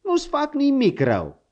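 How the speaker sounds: put-on exasperated old hunter yelling at a bucking horse; shouting at the horse. A narrator's reading. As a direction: bark an order to quit kicking.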